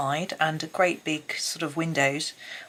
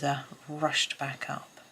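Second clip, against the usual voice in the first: ordinary talk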